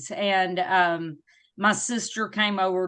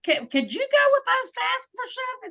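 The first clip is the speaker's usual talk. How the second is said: mock eager friends; 13 semitones higher than usual. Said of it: "They're like".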